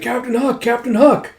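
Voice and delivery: high-pitched acted crowd cheer; adoring cheer. Troops hailing their captain, high excited cheer. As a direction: cheer the hero's name in a high voice.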